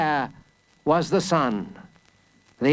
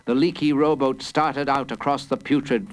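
plain reading voice

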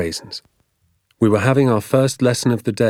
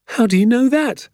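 high, louder surprised question; boy's eager question; 4 dB louder than usual. Acted. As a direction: ask it high and keen, like a curious child. Boy questioning his father in story.

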